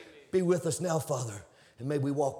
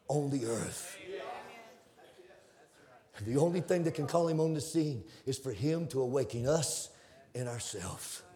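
ordinary talk, nothing marked

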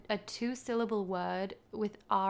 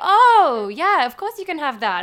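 loud, high, gushing mock realisation; mock sudden understanding; 8 semitones higher, 15 dB louder than usual. Server finally understanding the order, loud gushing mock realisation. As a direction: burst out as if it all suddenly clicks.

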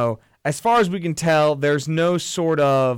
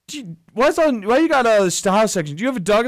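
higher, pushier mock-impression voice; mimicking a character; 6 semitones higher than usual. Retelling the movie's business-card scene in a raised mock voice. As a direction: toss the questions up high, prying. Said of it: "He's like".

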